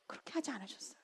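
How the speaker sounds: hushed whisper for emphasis; a preacher whispering the key point. Real, not acted. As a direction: breathe it out, almost no voice.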